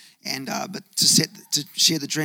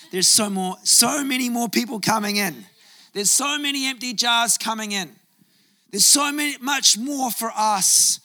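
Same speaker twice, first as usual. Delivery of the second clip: rising preaching; 7 semitones higher than usual. Acted. Pastor rallying the church about more people coming, rising fervor. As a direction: lift the congregation, voice climbing.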